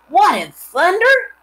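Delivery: a farmhand startled by a stink; shocked exclaim. A narrator's reading. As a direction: recoil at the smell. Lurvy shocked by smell.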